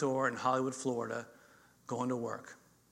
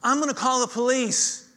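alarmed neighbor; 9 semitones higher, 6 dB louder than usual. Retold or imitated. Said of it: "So his neighbor's like".

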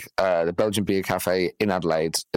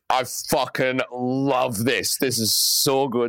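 sarcastic delight; 3 semitones higher, slower than usual. Retold or imitated. Generator dies, sarcastic joy.

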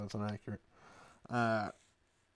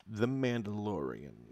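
dramatic announcer; slower than usual. Acted.

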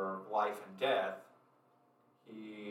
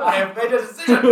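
mock proclamation; 13 semitones higher, 24 dB louder, faster than usual. Player parodies NPC while laughing.

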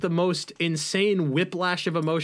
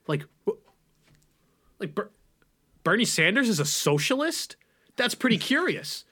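mock shocked pundit; 4 semitones higher than usual. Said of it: "acts as if that's the first time".

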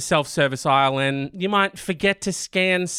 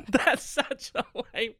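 soft, breathy laughing aside; laughing through words; 5 semitones higher, 8 dB quieter, slower than usual. Real, not acted. Host laughing at own lame steal story, laughing.

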